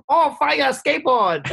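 high-pitched imitated Chinese accent. Grandma admiring the gift for her grandchild, imitated Chinese accent. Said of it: "Like, in some Chinese accent".

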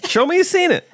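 high, playful sing-song tease; mock catchphrase. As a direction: toss it out like a goofy catchphrase.

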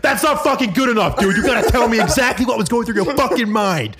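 high, insistent demand. Retold or imitated. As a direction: refuse to accept the answer, pitch up. Banks demanding a confession.

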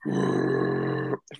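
a long, low gravelly growl held as long as possible; Cookie Monster growl attempt. A child in the car practising a monster growl. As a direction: rumble it out like a kid practising the monster voice. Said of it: "I would sit in the back of the car and I would go".

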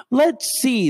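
wry mock-pondering; sarcastic lift on "let's see". Real, not acted. Sarcastic setup.

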